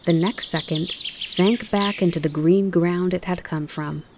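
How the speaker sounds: usual speaking voice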